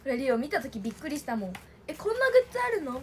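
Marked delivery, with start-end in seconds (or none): 1.9-3.0 quick, higher mock surprise at the goods; a light gasp of surprise; retold surprise. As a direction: replay the moment she first saw them. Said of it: "みたいな".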